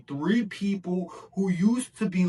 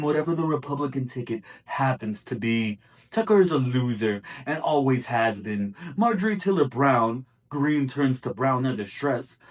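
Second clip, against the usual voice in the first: amateur impression of a boastful politician, pitched lower; Donald Trump; 3 semitones lower than usual. Host reads a Truth Social post aloud in an amateur Trump voice. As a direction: read it as a lower-voiced presidential put-down, in uneven bursts. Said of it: "try to do this in a Trump voice".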